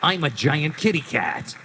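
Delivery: gruff Snake, playful. Shown as a demonstration. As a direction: say it like Snake, tongue in cheek. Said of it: "have you do the Ocelot growl in Snake's voice".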